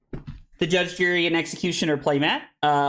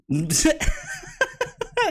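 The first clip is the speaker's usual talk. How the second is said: high laugh. Real, not acted. Laughs at own cat joke.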